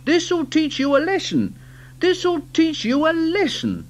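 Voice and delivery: high, stern telling-off; scolding. Acted. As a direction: scold the naughty trucks. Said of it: "he scolded the trucks".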